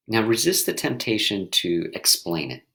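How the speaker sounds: ordinary talk, nothing marked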